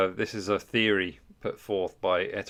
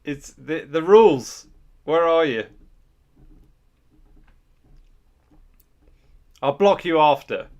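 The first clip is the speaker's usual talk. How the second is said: mock threat; 5 semitones higher, 10 dB louder than usual.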